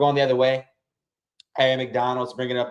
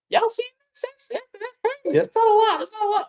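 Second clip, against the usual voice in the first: much higher, falsetto squeal of disbelief; squeaky disbelief. Real, not acted. Streamer to chat about the refs, falsetto squeal. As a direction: squeal it like you can't believe the call. Complaining about referee call.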